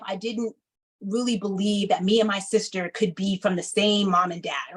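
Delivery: usual speaking voice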